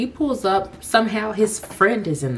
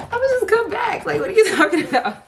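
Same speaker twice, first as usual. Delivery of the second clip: high, laughing through the question; laughing disbelief; 4 dB louder than usual. Real, not acted. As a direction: ask it while cracking up in disbelief.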